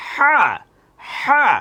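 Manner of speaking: "হা" with a strong breathy h from the throat; thick pronunciation. Shown as a demonstration. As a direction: squeeze the throat for "হা". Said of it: "একটু গাঢ় করে উচ্চারণ".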